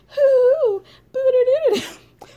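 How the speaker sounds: high, loud mock wailing; hammed-up crying howl; high, loud mock sobbing noises; imitated wailing cries. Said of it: "just a series of noises".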